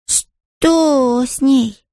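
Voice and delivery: put-on high, drawn-out child's question; little boy's voice. A narrator's reading. Gardar asks his brother and sisters, high childlike voice. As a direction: voice a small boy asking slowly.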